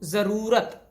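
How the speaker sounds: ज़रूरत said slowly with a clear long रू; clear pronunciation. Shown as a demonstration. Teacher slowly sounding out ज़रूरत for students. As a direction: stretch out ज़रूरत so the रू is heard. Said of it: "स्पष्ट आपको सुनाई दे रहा है".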